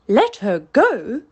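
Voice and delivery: loud, slow, disbelieving question; captain stunned his order was obeyed; shocked cry. A narrator's reading. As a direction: repeat it back in outraged disbelief. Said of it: "cried Hook".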